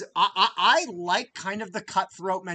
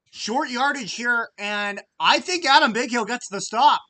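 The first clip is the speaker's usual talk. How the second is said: much higher and louder, calling the play as it happens; excited play call; 9 semitones higher, 12 dB louder than usual. Real, not acted. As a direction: shout it out as the defense makes the stop. Fan calling a big defensive stop.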